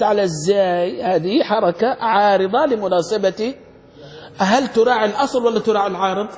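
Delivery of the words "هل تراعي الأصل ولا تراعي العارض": ordinary talk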